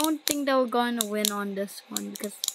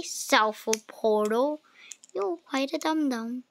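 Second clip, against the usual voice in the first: ordinary talk, nothing marked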